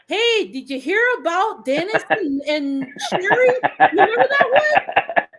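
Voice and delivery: imitating a Canadian uncle. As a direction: retell the Canadian uncle's voicemail, cracking up. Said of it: "my Canadian uncle is always funny".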